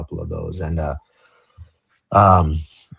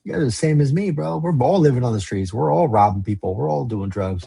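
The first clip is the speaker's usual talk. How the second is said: higher-pitched, insistent retort; retold protest; 7 semitones higher than usual. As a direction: argue back at the friends, pitch lifted. Retold protest at friends' intervention.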